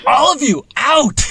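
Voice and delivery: high, loud bellowing bison. Retold or imitated. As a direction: voice the fed-up bison shouting. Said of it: "And it was like".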